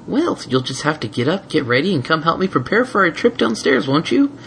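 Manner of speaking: sweet, coaxing mare; gentle mare voice. Acted. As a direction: coax him out of bed. Twilight voiced.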